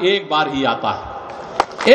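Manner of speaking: very low, slow, drawn-out line; low, solemn praise. Real, not acted. Speaker praising the prime minister as a rare leader, low and slow. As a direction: sink down slowly on the praise.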